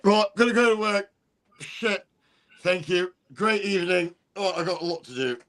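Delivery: high, loud British builder; a cheery builder heading off to work. Retold or imitated. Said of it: "They're like".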